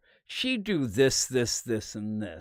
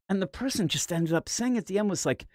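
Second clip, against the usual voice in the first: normal talking voice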